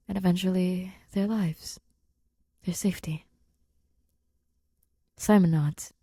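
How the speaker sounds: ordinary delivery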